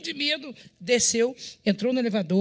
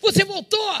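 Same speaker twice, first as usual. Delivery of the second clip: the question repeated much higher in startled disbelief; surprised, higher; 10 semitones higher, 4 dB louder, slower than usual. Retold or imitated. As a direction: jump up in pitch as if startled. Confronting the returned visitor.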